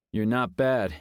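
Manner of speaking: a grudging big man; deep gruff voice. Acted.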